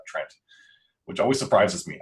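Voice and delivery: ordinary talk, nothing marked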